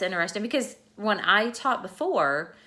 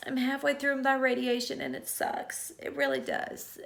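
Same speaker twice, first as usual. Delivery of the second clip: real tears, voice pitched up and breaking; fighting back tears while admitting it sucks; choked-up and tearful; crying; 5 semitones higher than usual. Real, not acted. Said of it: "So I'm done crying".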